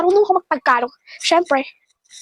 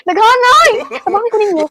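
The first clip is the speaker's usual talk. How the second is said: mock wail; 6 semitones higher, 5 dB louder than usual. Real, not acted. Overwhelmed by pile of laundry.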